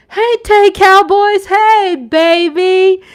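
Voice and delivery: high, loud, drawn-out hey to the fans; loud excited greeting. Real, not acted. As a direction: call out to the Cowboys fans with joy. Greeting fans on her stream.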